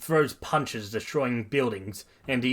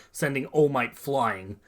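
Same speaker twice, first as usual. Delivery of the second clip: ordinary delivery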